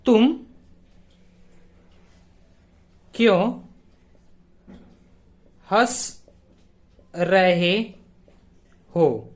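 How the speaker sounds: each word set apart by long pauses. Shown as a demonstration. Teacher spelling out the question for students, slow and spaced. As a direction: dictate it slowly, one word at a time. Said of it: "हमने क्या पूछा".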